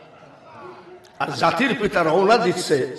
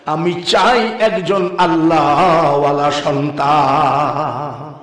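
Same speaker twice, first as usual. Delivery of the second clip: melodic sermon storytelling; 2 semitones higher, 5 dB louder than usual. A narrator's reading. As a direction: chant the story with long drawn-out phrase endings.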